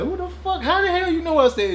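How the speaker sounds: high, incredulous questioning; agitated. Retold or imitated. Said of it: "I'm, I'm, I'm agitated".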